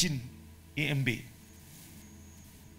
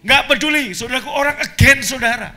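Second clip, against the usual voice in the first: loud, high, emphatic sermon delivery; ringing preacher's declaration; full-force preaching; 6 semitones higher, 8 dB louder than usual. Real, not acted. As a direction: proclaim it to the congregation with mounting force.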